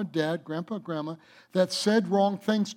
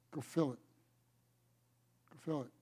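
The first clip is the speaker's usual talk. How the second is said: quiet command; 3 semitones lower, 12 dB quieter, faster than usual. Acted. Jesus quoted giving command.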